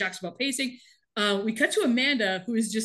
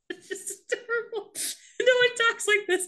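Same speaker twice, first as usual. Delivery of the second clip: much higher, delighted gush; 12 semitones higher than usual. Real, not acted. Gushing to her co-host about the cute character, high and delighted. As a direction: squeal it like he's the cutest thing.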